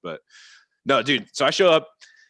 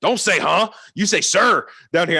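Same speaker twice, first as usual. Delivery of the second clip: higher, louder mock scolding; imitating a yelling coach; 9 semitones higher, 6 dB louder, slower than usual. Coach scolding the player again, mock yell. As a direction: scold like a hard-nosed coach. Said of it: "yelled at me".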